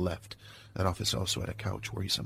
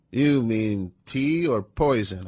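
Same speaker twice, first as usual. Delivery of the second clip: dry suspicious; 3 semitones higher, 5 dB louder, slower than usual. A narrator's reading. Hero answers suspicious offer.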